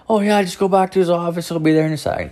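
light high put-on voice; breezy receptionist. Voicing receptionist in retold visit.

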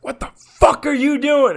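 high-pitched yell at a neighbor; retold screaming. Podcaster retelling his row with a neighbor, screaming. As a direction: tear into him, pitch jumping up. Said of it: "I've screamed at a neighbor".

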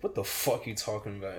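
lightly voiced low sergeant; a hint of a big deep-voiced sergeant; deep-voiced drill sergeant. Drill sergeant answering a kid's stupid question, lightly mimicked deep voice. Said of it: "he had a deep ass voice".

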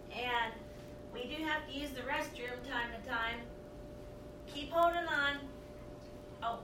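distant voice from the next room; talking far off the mic; from another room. Real, not acted. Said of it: "I'm gonna keep talking from the other room".

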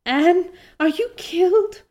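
high-pitched frantic cry to a friend; acted shriek. A narrator's reading. Diana to fallen Anne, acted shriek. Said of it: "shrieked Diana".

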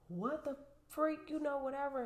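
shocked neighbor. Retold or imitated. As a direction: play the startled neighbor in a high squeal. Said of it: "and she's like".

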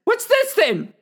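high, loud accusing voice; bloodied woman accuses, high and loud. Acted. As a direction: confront him with the evidence. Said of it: "She says".

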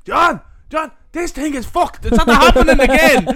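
hammed-up alarm, shouting across to John; mock alarmed shout. Ride operator shouting to his mate that the ride is broken, mock alarmed shout. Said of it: "he's like".

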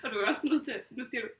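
normal talking voice

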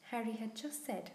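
ordinary delivery, nothing marked

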